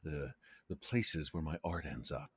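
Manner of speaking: hushed aside, leaning in; theatrical whisper meant to be overheard; stage whisper. Acted. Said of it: "like, stage whisper".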